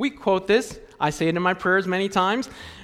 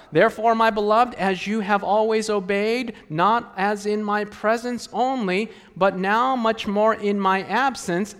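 scripture reading; 4 semitones higher than usual. Acted.

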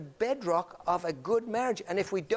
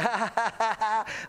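put-on "ha-ha-ha" chortle; imitated group laughter; 4 semitones higher than usual. Speaker imitating youngsters before Sabbath school, mock laughter. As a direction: mimic kids laughing together. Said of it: "they're all talking and they're laughing".

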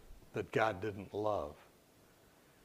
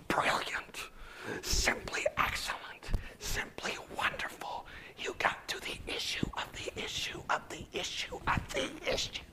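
intensifying whispered praise. Retold or imitated. Heaping praise on the patient, forceful building whisper. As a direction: push the whisper harder with each repetition.

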